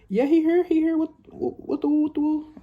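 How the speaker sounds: gossiping mom telling his friend's mom; mom on the phone. Retold or imitated.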